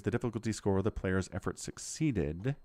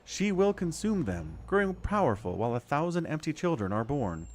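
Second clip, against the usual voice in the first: lightly voiced goddess, grave; a hint of a solemn goddess, higher; 7 semitones higher than usual. Reads the goddess's lines aloud.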